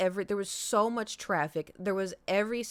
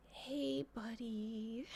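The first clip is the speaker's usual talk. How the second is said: higher, soft, tender coaxing; tender to pet; 4 semitones higher, 10 dB quieter, much slower than usual. Real, not acted. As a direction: ease the frightened cat gently. Soft words to sick cat.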